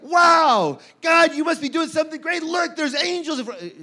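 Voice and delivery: high, loud burst of wonder; mock amazement. Preacher acting the reaction Mary should have to angels, mock amazement. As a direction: gush as if awestruck.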